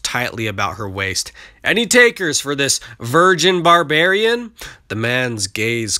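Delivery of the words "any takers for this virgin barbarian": high, loud sales call; loud auctioneer call. A narrator's reading. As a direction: shout it over the market crowd. Auctioneer calling to buyers.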